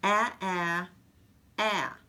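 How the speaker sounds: ah said slowly and clearly, one at a time; dictation sound. Shown as a demonstration. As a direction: dictate ah with pauses between. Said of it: "Here's another one".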